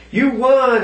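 high, sing-song mock scammer voice. Mocking the lottery scammer's pitch to the viewers. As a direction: squeak it out like a fake prize caller. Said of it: "He's like".

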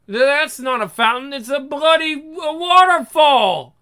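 stammer, then blast out waterfall; a stuttering shout building to a yell; outraged surprise. Acted.

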